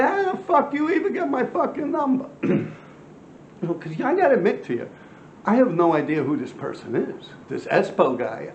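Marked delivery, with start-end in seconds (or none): 0.0-2.3 much higher, half-shouted disbelief; replaying the moment he snapped at the caller; retold outraged demand. To the book writer on the phone, a mock outraged high-pitched demand.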